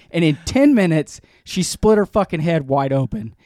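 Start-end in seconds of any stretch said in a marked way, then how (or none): none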